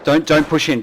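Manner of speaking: high loud warning; urgent warning. Real, not acted. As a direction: stop them from pushing in. Warning teammate sharply.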